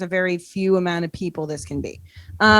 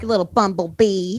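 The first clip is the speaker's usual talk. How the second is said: Andy Dick impression. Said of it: "now the way you said that, that's Andy Dick".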